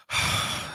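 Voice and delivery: a long unvoiced tired sigh; weary sigh. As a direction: sigh into the phone like you already know. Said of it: "he answers the phone like this".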